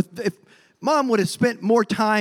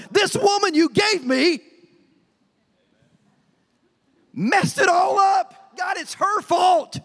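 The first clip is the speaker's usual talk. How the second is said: Adam turns on God and his wife, shouting blame; blaming shout; 6 semitones higher, slower than usual. Acted. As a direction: hurl the blame at her, slowly.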